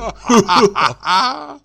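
high delighted whinny; drunken neighing laugh. A narrator's reading. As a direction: neigh with pleasure at the vodka. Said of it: "заржал от удовольствия". (пьяное ржание от удовольствия)

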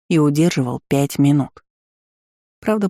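usual speaking voice

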